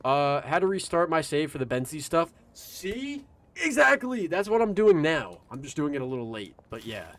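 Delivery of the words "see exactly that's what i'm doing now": high, loud excited agreement. Real, not acted. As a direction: cheer it like you called it. Excited reply to chat.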